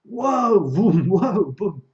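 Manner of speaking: loud, high whoas and booms; big, explosive sound-effect excitement; exhilarated whooping. Shown as a demonstration. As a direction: whoop like a wild thrill ride. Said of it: "or like this".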